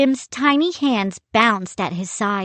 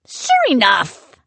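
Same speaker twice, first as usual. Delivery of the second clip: shrugging; 5 semitones higher, 6 dB louder than usual. A narrator's reading. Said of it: "he shrugged".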